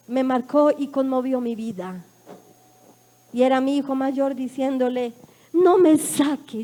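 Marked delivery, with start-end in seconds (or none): 5.5-6.6 loud, slow begging; son's tearful plea. Retold or imitated. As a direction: plead like a crying boy.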